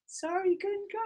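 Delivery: faint falsetto call from far off; fading distant call. Pretend. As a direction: sing it out as if driving away. Riders calling, fading as bus leaves.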